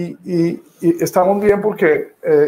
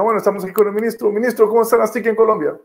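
quick, higher announcer patter; rushed TV host; 6 semitones higher, faster than usual. Retold or imitated. Journalist restarting the live interview, rushed host voice. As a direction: rattle it off like a hurried anchor.

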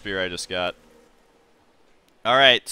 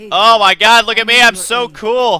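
excited; 9 semitones higher, 11 dB louder than usual. Real, not acted. Admiring new gear.

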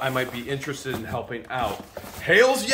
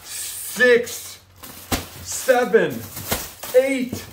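high, drawn-out triumphant tally; high-pitched celebratory count; excited counting; 8 semitones higher, much slower than usual. Real, not acted. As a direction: call out each number with mounting thrill. Building count of donated bags.

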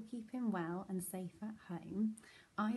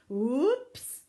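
loud, rising startled yelp; high, sudden Santa cry; cried out; 4 semitones higher, 12 dB louder than usual. A narrator's reading. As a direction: yelp as you slip. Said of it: "cried Santa".

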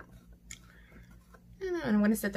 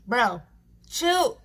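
louder, half-laughing bro chill; exasperated call; 10 dB louder than usual. Real, not acted. At the flying powder, a loud exasperated bro chill. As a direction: snap at the mess to calm down.

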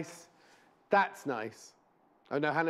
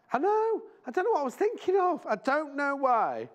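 high embarrassed exclaiming; 11 semitones higher, 4 dB louder than usual. Real, not acted. Embarrassed about her own mistake.

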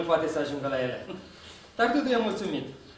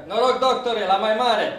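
loud toast, raised voice; cheering shout; 3 semitones higher, 7 dB louder, slower than usual. Retold or imitated. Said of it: "strigăm". (strigăt de toast, tare)

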